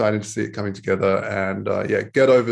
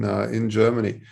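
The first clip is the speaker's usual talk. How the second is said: normal talking voice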